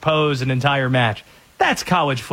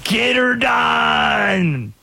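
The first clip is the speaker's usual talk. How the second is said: put-on Southern accent; 6 semitones higher, much slower than usual. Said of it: "It's always the Southern accent".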